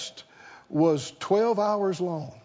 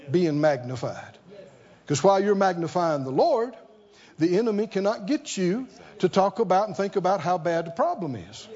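normal talking voice

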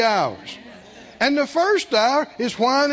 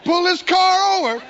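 loud, higher warning from the driver's seat; parent's threat; 8 semitones higher, 5 dB louder than usual. Retold or imitated. Parent threatening whining kids in the car, loud and sharp. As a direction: snap back like a fed-up parent. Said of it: "The response is".

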